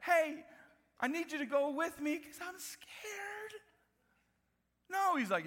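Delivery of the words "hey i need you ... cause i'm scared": high, slow, timid plea for company; mock scared warrior. Warrior begging the judge to come, mock scared. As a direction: whine it like a frightened soldier. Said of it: "a warrior does not like".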